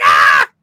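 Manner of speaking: a hammed-up yelp of a kidnapped girl; mock kidnapped scream. Gamer to friends imitating Ashley being taken, hammed-up scream. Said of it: "She's like".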